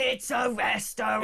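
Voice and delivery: slow, shouted mock-outraged impression; Nigel Farage. Host quoting a politician on gastro pubs, hammed-up shout. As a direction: bellow it slowly, imitating a politician. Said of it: "So he always goes".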